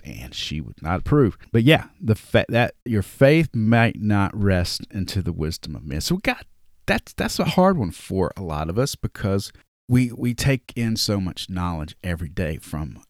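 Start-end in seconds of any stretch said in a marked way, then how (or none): none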